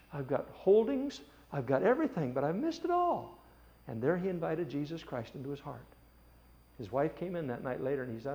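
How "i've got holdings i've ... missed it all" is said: anguished cry. Retold or imitated. Rich man repents, anguished.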